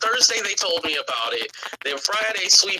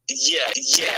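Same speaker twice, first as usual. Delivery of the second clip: ordinary talk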